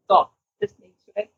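ordinary talk

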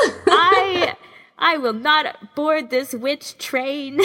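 high, slow, half-laughing protest. Real, not acted. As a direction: giggle out a mock refusal. Mock refusal amid laughter.

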